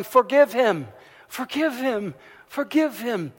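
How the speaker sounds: put-on loud, high chant of a crowd of kids; children shouting. Students shouting at the principal for mercy, loud and high. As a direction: call it out, loud and pleading. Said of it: "they started shouting".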